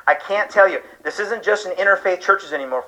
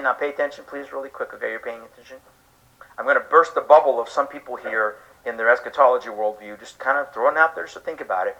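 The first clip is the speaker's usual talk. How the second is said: quiet, confiding aside to the congregation; hushed aside; 6 semitones lower, 5 dB quieter than usual. Real, not acted. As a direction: drop to a low hush, confiding.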